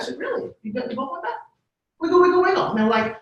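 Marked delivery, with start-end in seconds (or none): 0.2-1.4 high, soft teasing disbelief; playful mom feigning surprise; mock surprise. Mom teasing daughters' request. 2.0-2.7 high, bright sing-song mom reply; cheerful mom command. Retold or imitated. Mom giving the wiggle command.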